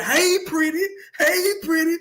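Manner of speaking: high teasing call, sing it out; high mocking taunt. Coach taunting the other coach's son, high mocking call. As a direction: needle him from the sideline. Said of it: "So I kept saying".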